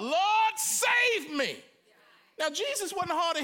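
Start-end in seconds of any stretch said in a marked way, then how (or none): 0.0-1.6 much higher, louder panicked cry. A narrator's reading. Peter sinking, crying out to Jesus. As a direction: cry for rescue. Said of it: "he cried out".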